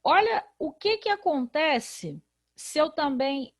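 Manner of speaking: usual speaking voice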